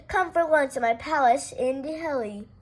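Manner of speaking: usual speaking voice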